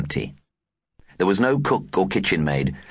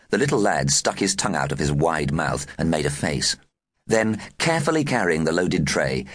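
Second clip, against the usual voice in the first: ordinary delivery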